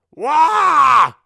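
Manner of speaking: high, loud held scream. Acted. Melvin flees charging monsters, screaming. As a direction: wail out in alarm. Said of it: "I screamed".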